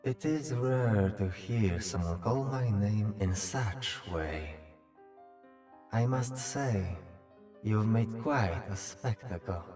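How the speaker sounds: measured, echoing otherworldly voice; summoned otherworldly being. Acted. Summoned Ming greets the one who called, composed otherworldly voice.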